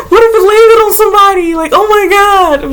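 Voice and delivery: gasping, high and horrified. Retold or imitated. Replaying her ex flicking the bug, a high horrified cry. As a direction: shriek it in alarm, slowing on oh my God.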